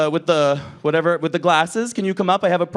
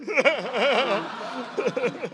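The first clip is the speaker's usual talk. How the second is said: high, rattling cackle; 7 semitones higher, 7 dB quieter than usual. Real, not acted. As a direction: let out a long high cackle. Performer's own laugh after teasing.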